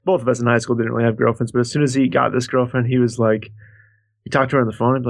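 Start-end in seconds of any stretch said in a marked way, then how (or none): none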